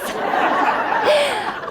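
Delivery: a high, rueful burst of laughter at herself; real laugh. Real, not acted. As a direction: laugh at your own mess. Rueful laugh at own state.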